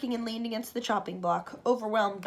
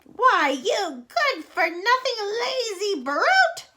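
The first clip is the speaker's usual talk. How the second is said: much higher and louder, slow furious shout; 10 semitones higher, 7 dB louder, much slower than usual. Acted. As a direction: shout the insult at the boy. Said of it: "Aunt Spiker shouted".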